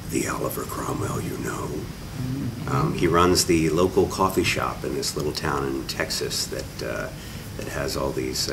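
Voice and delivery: low, hushed horror movie trailer voice. Shown as a demonstration. Actor teasing his vampire role, in a hushed horror voice. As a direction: drop into a lightly creepy trailer hush. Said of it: "That's my horror voice".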